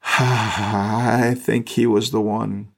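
sigh, then very slow, drawn-out words; slow realization. Retold or imitated. Roman soldier at the cross, slowly realizing with a sigh. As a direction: let it out slowly after a heavy sigh.

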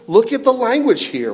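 usual speaking voice

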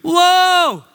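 loud, high startled whoa; shocked whoa. Retold or imitated. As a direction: cry out at the flood of bad news. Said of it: "I'm like".

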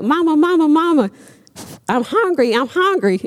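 put-on high, pleading baby bird voice; little chicks begging mama for food. Baby birds begging their mother, high and pleading.

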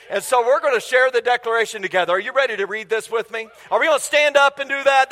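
ordinary delivery

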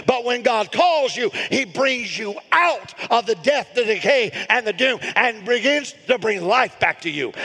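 high, driving pulpit preaching; full-force preaching. Real, not acted. Pastor urging congregation to answer God's call, impassioned preaching. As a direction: preach it high and insistent, hammering repeated phrases.

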